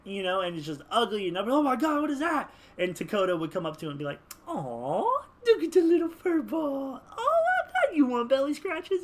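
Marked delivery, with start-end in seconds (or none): 1.5-2.5 high, loud mock alarm; high-pitched pretend freak-out; acted horror. Acted horrified reaction to ugly pet. 4.5-9.0 very high, loud cooing; baby-talk a monster like a beloved puppy; squealing animal lover; gushing pet talk. Acted.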